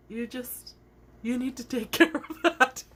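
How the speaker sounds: high voice laughing mid-line; boss laughing. Retold or imitated. Boss repeating advice, high and laughing. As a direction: giggle through the advice. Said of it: "She goes".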